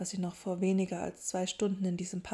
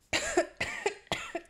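high, choked coughing; acted coughing fit. A narrator's reading. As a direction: cough as if a strong drink burned. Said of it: "McGonagall hustete".

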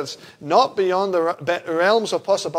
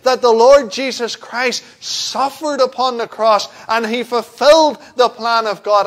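emphatic, raised pulpit voice; full-force preaching; 5 semitones higher, 7 dB louder than usual. Real, not acted. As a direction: drive the message home forcefully.

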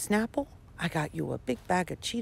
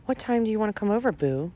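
ordinary talk

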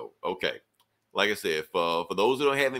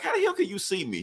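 mock surprise; 11 semitones higher, faster than usual. Retelling Dead Man's surprise.

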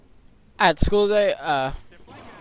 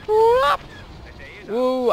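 squeaky high whoop, louder; happy whoop; 3 dB louder than usual. Real, not acted. Gamer celebrating a kill with a high whoop. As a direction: whoop with delight.

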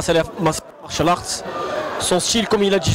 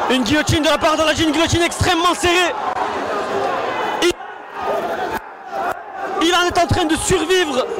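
higher, urgent play-by-play; excited; 8 semitones higher than usual. Real, not acted. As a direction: call the submission as it tightens. Commentator calls tight guillotine.

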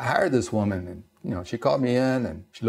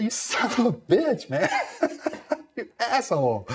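high, cracking up through the curse; laughing mock insult; 13 semitones higher than usual. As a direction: swear at a friend through helpless laughter. Cursing friend while laughing at the punchline.

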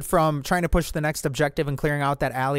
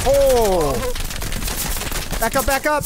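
alarmed shout; 9 semitones higher, slower than usual. Real, not acted. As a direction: yell to fall back. Sudden danger, retreat call.

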